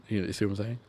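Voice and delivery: ordinary talk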